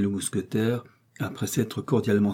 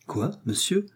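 indignant exclamation; 8 semitones higher than usual. A narrator's reading. D'Artagnan stung by Aramis's rebuke, indignant exclamation. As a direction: catch the insult in disbelief. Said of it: "s'écria D'Artagnan".

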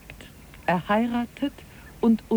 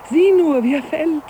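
higher, louder thrilled call; delighted high exclaim; excited; 8 semitones higher, 5 dB louder than usual. Acted. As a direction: point it out with glee. Watching compass fall.

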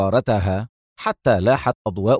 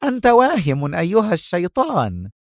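higher, cutting retort; mild fierce scorn; sharp cry; 6 semitones higher than usual. Acted. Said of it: "صاحت سونيا في حدة". (صيحة حادة)

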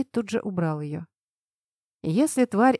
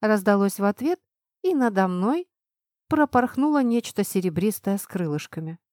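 ordinary delivery, nothing marked

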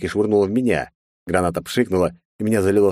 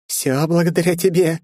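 high-pitched gloating jab; giggling taunt; 10 semitones higher than usual. A narrator's reading. Wounded Margaret taunts the hero, giggling spite. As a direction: throw the blame back with gleeful spite. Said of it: "хихикая ответила Маргарет".

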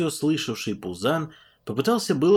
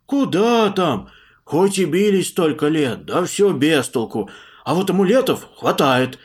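loud, eager peasant monk; 6 semitones higher, 7 dB louder than usual. Acted. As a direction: spill it all out loudly. Puzan eagerly reporting.

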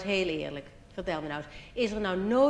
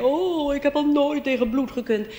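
high, louder, fainting-fit voice; squeamish angel; 6 semitones higher, 6 dB louder than usual. Pretend. Third angel squeamish about blood, high and loud. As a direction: gasp it like a delicate angel. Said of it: "een andere witte engel en die zei".

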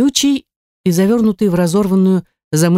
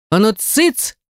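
a stern shush, raised; sharp hush; 5 semitones higher, slower than usual. Acted. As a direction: snap it out to silence him. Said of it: "прикрикнула я". (резкий окрик)

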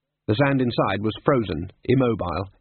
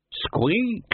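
rat squeak; 10 semitones higher than usual. Acted. Death of Rats squeaks.

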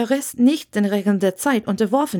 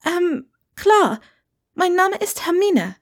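put-on higher, hesitant young witch; Hermine, hesitant; 10 semitones higher than usual. A narrator's reading. Hermine introduces herself to Wendeline, high and unsure. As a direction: stumble a little, then give your name.

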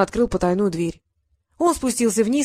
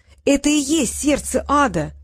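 higher-pitched, stunned realisation; shaken exclamation; 5 semitones higher than usual. A narrator's reading. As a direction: exclaim it as a shocked discovery. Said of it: "воскликнул потрясённый Трикс".

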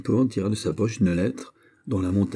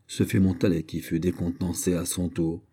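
plain reading voice

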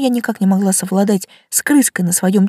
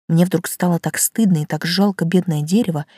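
ordinary delivery, nothing marked

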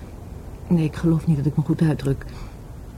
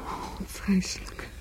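slow, breathless whisper of shock; horrified whisper; 3 semitones higher, 14 dB quieter, much slower than usual. Acted. Woman told how her lover died, slow horrified whisper. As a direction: barely breathe it out.

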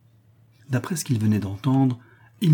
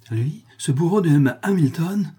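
higher, rising in horrified disbelief; horrified outcry; 7 semitones higher, 4 dB louder than usual. A narrator's reading. Son hears the admiral's name, horrified outcry. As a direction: recoil at the name with horror. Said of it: "se récria le jeune homme avec une expression d'horreur".

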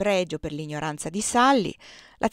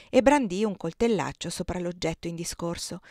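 ordinary delivery, nothing marked

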